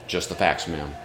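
a hint of a low deadpan detective; Joe Friday. Storyteller likens eulogist to TV detective, lightly deadpan. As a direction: lightly imitate a low, understated TV cop. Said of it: "reminded me of Joe Friday from Dragnet".

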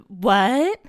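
loud startled what; shocked. Acted. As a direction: blurt it out in shock. Joaquin appears covered in blood.

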